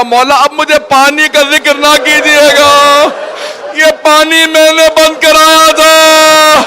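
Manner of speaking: slow, high, breaking wail of remorse; weeping plea. Acted. Hurr weeping before his Imam, slow high wail. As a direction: cry it out slowly, voice rising in grief.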